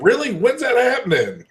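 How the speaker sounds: high-pitched questions from excited emailers; eager emailers. Acted. Host mimicking fans flooding inbox with emails, eager high voice. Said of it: "you're gonna get a thousand emails going".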